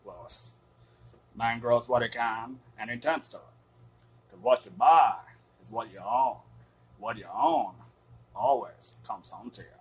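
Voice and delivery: put-on channel Fred Gwynn's Judd with a thick Maine accent. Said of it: "we don't get that classic Fred Gwynn accent".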